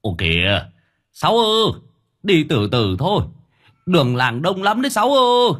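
high, loud call; older brother calling a warning down the road; calling after. Acted. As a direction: shout after the departing car. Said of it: "Ông Bảy đứng nhìn theo nói với".

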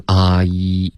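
slow "啊" sliding into "一"; model diphthong slide. Shown as a demonstration. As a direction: demonstrate the glide "啊，一". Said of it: "所以也是一个双元音".

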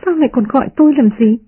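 high-pitched, quick retort; lightly voiced maid snubbing him; indignant maid. A narrator's reading. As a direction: fire back fast and high. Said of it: "Người kia vùng dậy nói".

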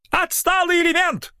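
very high yelled insult; heckler shout. Acted. Heckler yells at Mykola, very high. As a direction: hurl it from the back of the hall. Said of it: "донеслось из зала".

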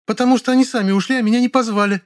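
high, ringing with hurt; indignant, offended high-pitched whine. A narrator's reading. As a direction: protest in a high, wounded female voice. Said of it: "возмущённо сказала она".

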